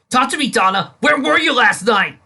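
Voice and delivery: loud, high pleading shout; husband shouting at cheating wife; desperate demand. Acted. Dick confronts wife.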